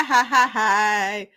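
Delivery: ordinary delivery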